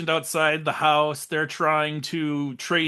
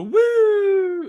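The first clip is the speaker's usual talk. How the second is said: mock cop whoop; 7 dB louder than usual. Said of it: "you see the cops go".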